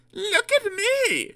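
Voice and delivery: high, drawn-out, self-admiring purr. A narrator's reading. Daisy to the waiting passengers, purring vainly. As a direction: show off slowly in a high diesel voice. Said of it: "she purred to the waiting passengers".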